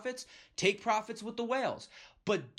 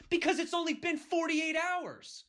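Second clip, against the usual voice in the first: high, exasperated outburst at impatient buyers; heated; 10 semitones higher than usual. Real, not acted. Vlogger venting at impatient coin buyers, heated and high. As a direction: let the frustration spill over, pitched way up. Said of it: "I know I'm getting a little heated here".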